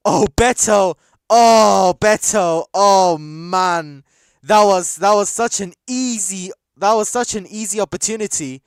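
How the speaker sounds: loud, high groans of frustration; anguished shout. Real, not acted. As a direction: shout out the player's miss in disbelief. Player misses easy chance.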